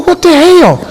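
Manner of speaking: can't believe the crowd; shocked. Retold or imitated.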